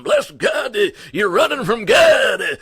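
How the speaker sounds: high, loud hellfire preacher voice; mock a shouting Southern revival preacher; hammed-up Southern preaching; hacking Southern preacher. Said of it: "go into his hacking Southern preaching voice".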